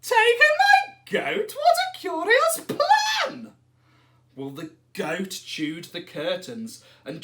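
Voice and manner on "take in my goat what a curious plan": put-on very high, loud squeaky old lady; little old lady. A narrator's reading. Old lady protests to the wise man, high and squeaky.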